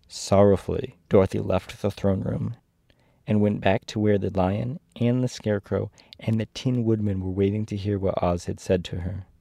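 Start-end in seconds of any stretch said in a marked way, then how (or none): none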